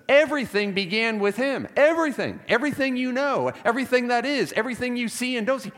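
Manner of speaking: EVERYTHING hit high at each phrase start; emphatic repetition. Real, not acted. Preacher pressing the point that all began with Jesus, emphatic. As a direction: drive home EVERYTHING, pitched high and falling.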